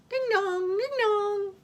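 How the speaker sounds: high sing-song ding-dong; doorbell imitation. A narrator's reading. Wolf rings the cottage bell, high chiming voice. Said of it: "Wolf rang the bell".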